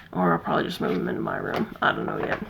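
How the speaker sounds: soft whispered aside; low whispered afterthought. Real, not acted. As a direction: murmur it to yourself. Aside to herself.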